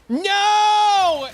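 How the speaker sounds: high, loud whining NO; hammed-up petulant refusal; mock whiny no. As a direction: whine it like a spoiled kid. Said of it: "they're like".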